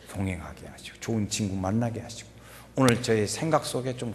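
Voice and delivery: put-on low, soft bedtime prayer over a child; parent's prayer. Shown as a demonstration. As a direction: pray quietly for your children. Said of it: "그걸 기도해 주는 거예요". (낮고 조용한 기도)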